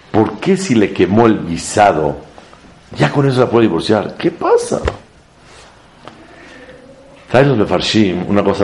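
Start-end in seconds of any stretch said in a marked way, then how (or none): 4.2-4.8 far higher, squeaked; mock surprise. Real, not acted. Rabbi to class asking what is wrong, mock squeak. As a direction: pop the question in a comic falsetto.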